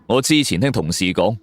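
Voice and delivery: ordinary delivery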